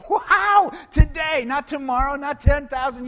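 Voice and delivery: loud, high, joyful cry; exultant shout. Real, not acted. Preacher bursting out joyfully to the congregation. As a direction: shout the good news out with joy.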